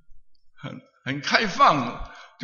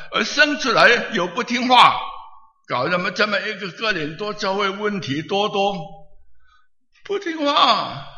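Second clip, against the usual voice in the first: high, loud preaching voicing Paul; fervent preaching; 4 semitones higher, 3 dB louder than usual. Preacher voicing Paul to the Corinthian church, high and loud. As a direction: preach it out as the apostle.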